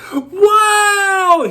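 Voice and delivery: put-on a three-year-old's shout of delight, very high and loud; thrilled little boy yelling wow; child's excited wow. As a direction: shriek with joy at the present. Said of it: "and he's like".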